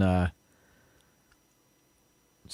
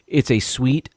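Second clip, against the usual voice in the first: ordinary talk, nothing marked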